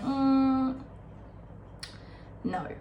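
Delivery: ordinary talk, nothing marked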